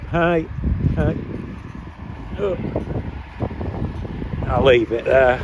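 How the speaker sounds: normal talking voice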